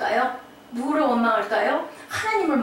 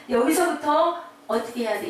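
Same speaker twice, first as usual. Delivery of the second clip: ordinary delivery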